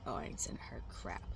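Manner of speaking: low, soft whisper; muttered refusal. Retold or imitated. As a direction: mumble it under your breath, stubborn. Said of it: "And I'd be like".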